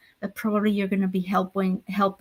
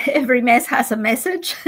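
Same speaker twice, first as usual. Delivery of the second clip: laughing saying; 5 semitones higher than usual. Real, not acted. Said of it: "like we say".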